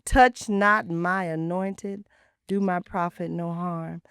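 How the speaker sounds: scripture quote. Retold or imitated. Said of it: "So I said".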